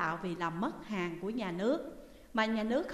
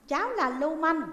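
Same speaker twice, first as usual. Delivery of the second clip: put-on cocky boy; 7 semitones higher, 5 dB louder than usual. A narrator's reading. Boy boasting to the prisoners.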